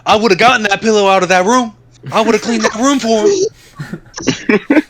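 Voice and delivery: high, fretting mom voice, slowing at the end; imitating his mom. Mom apologizing to her son about the pillow, high and fretting. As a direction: play an apologetic, flustered mom. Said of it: "His mom like".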